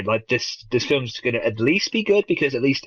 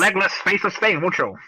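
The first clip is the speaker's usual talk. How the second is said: put-on higher old-time movie gangster patter; hammed-up 1940s movie voice; nineteen-forties wise guy; 8 semitones higher than usual. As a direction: talk like a forties studio tough. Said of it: "suddenly he went all nineteen forty".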